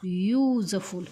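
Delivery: slowly spelled-out युजफुल, yoo-zuh-ful; teacher drawing out युजफुल syllable by syllable; slow model word. Shown as a demonstration. Teacher modelling the word for students, slow and clear. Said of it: "काय घेतला?".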